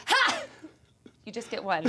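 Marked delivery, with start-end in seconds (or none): 0.1-0.4 one sharp high "Ha!"; single laugh. Shown as a demonstration. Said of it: "funny, I go".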